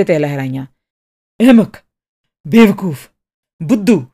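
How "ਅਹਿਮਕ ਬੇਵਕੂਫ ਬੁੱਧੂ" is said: loud, furious insults fired one at a time. A narrator's reading. Enraged artist shaking his fists at Miss Martha, shouting. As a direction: shout each insult separately, wild with anger. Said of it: "ਬਹੁਤ ਹੀ ਉੱਚੀ ਆਵਾਜ਼ ਵਿੱਚ ਬੋਲ ਰਿਹਾ ਸੀ".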